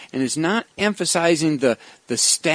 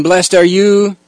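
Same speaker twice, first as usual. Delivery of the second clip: louder, rising blessing that hooks the listeners; playing to the crowd; 3 semitones higher, 7 dB louder, slower than usual. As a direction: call it out to draw the crowd in. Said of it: "he was actually playing with the crowd".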